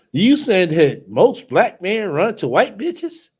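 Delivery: high, loud, slow incredulous challenge; incredulous outrage. Real, not acted. Reacting to a clip, loud high incredulous challenge. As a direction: drag it out like you can't believe it.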